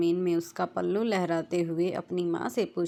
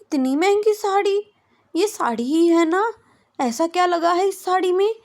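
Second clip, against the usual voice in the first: much higher, louder pouty protest; pouting disbelief; 11 semitones higher, 7 dB louder than usual. A narrator's reading. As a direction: pout and scoff at the price. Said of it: "मुँह चढ़ाते हुए कहती है".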